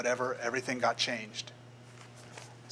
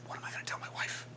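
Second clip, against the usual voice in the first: unvoiced worried whisper; whispered worry; 8 dB quieter, faster than usual. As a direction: breathe it out under your breath. Son worried after losing job.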